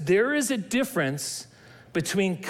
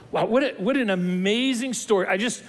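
ordinary talk

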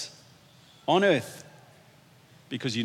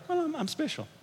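small, high, soft coy voice; coy mock modesty; 12 semitones higher, 9 dB quieter than usual. Card holder answering why they got that color, coy and high. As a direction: say it softly, high up, playing bashfully important.

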